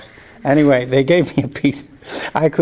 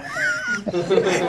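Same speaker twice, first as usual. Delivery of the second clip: much higher quick burst of laughter; real laughter, higher; 6 dB quieter than usual. Real, not acted. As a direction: cackle at the joke. Laughing after the punchline.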